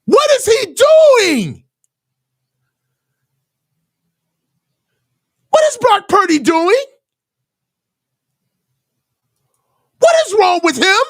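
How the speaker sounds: high-pitched outraged yelling at the screen. Real, not acted. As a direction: yell at the TV in disbelief at the throw. Fan raging at interception on TV.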